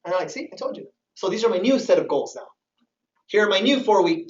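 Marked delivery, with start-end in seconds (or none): 0.3-0.9 whispered smug told-you-so. Retold or imitated. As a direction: hiss it, pleased to be right. Imitating smug relative.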